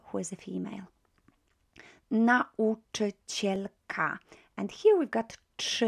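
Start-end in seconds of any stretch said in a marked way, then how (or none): 2.1-4.2 Nauczycielka said slowly with each vowel kept apart; slow pronunciation. Shown as a demonstration. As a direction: give every vowel in Nauczycielka its own beat. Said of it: "vowels ... don't get squashed".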